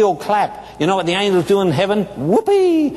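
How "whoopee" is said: whoopee cheer. Acted. Angels cheering in heaven.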